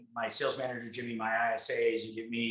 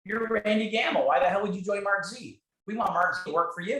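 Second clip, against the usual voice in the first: much higher and louder, pumped-up retort; retold hyped reply; 8 semitones higher, 4 dB louder than usual. Speaker hyping his friend up, in a high excited voice. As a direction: fire it back with excitement. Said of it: "I go".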